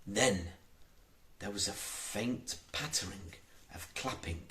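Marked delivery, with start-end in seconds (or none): 1.4-4.4 soft. A narrator's reading. Soft narration.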